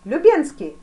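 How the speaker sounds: loud, high shout for an aide; summoning call. A narrator's reading. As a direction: call out the name sharply. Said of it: "опять позвал адъютанта великий князь". (громко подзывая)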